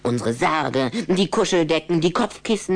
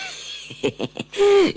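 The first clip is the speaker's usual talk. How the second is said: high gleeful vampire laugh; a sly giggle before the rhyme. Acted. Rüdiger laughing slyly before revealing Anna's diet, high giggle.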